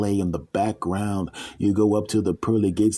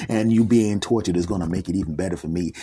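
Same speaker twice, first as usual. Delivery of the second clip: ordinary talk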